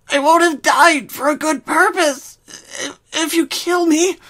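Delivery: high, loud tearful plea; sobbing. Acted. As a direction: beg through sobs. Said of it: "Rainbow sobbed".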